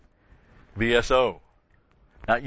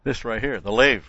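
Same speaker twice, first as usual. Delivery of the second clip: ordinary delivery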